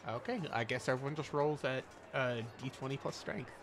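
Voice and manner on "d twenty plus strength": ordinary talk, nothing marked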